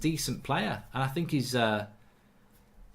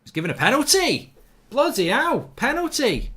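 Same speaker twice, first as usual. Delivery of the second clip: shocked disbelief; 12 semitones higher, 6 dB louder than usual. Real, not acted. Referee gives penalty against his team.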